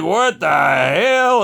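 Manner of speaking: high, drawn-out disbelief; imitated astonishment. Kids coming out at the screaming, astonished. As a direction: stretch it out slowly, up high.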